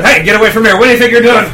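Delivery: high, loud, frantic dogs yelling from a car. Retold or imitated. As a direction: shout like a dog guarding the car. Voicing barking dogs again.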